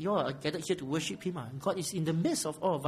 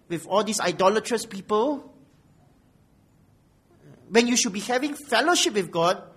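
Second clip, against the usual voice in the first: raised, reproving sermon voice; forceful preaching; 5 semitones higher, 7 dB louder than usual. Real, not acted. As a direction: press the rebuke on the congregation. Rebukes congregation in preaching.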